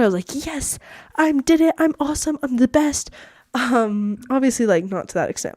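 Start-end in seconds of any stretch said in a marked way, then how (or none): 0.5-3.1 high-pitched, acted-out exultation; joyful triumph. Speaker reliving getting accepted, joyful triumph. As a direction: boast with delight, pitched up high. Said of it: "I was feeling such joy".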